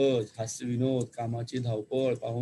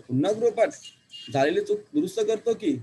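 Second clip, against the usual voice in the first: whining protest; 8 semitones higher, 3 dB louder than usual. Acted. Child protests redoing homework.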